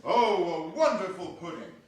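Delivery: Bob's delighted praise. Acted. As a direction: gush over the pudding. Bob praising the pudding.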